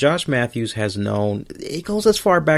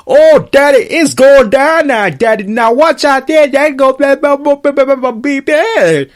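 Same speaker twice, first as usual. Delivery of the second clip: much higher and louder, a wild over-the-top mock of Pope; hammed-up Pope; 11 semitones higher, 8 dB louder than usual. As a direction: blast it out like a wrestler cutting a crazy promo. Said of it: "I got Pope".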